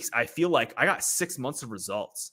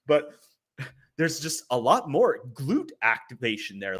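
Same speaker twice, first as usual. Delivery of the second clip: ordinary talk, nothing marked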